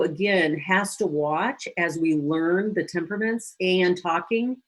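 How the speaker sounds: ordinary talk, nothing marked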